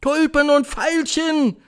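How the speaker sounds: high, slow, loud echo; jubilant crowd. A narrator's reading. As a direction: cheer it back, stretching the words. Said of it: "jubelten die Zuhörerinnen". (jubelnd, hoch und gedehnt)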